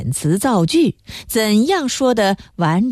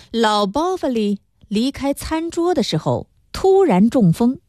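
plain reading voice

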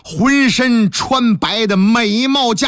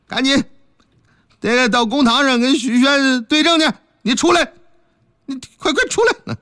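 higher, rising to a frightened yell; nervous official shout; 6 semitones higher than usual. Acted. 何捕头硬着头皮命令白娘子出来，越喊越高. Constable orders ghost out, scared.

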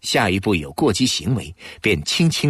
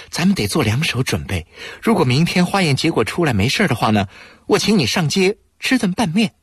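put-on caring young woman; 8 semitones higher than usual. Anqi comforts her partner.

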